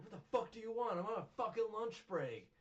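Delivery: loud, high-pitched indignant yell; acted angry outburst. Santa interrupted on his lunch break, acted furious yell. Acting Santa snapping at mom.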